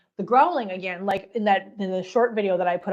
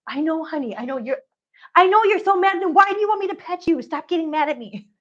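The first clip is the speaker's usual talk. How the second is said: pitched-up coaxing to a pet; cooing pet talk; 5 semitones higher than usual. Real, not acted. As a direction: soothe a grumpy kitty in a cooing voice. Cooing at her grumpy cat.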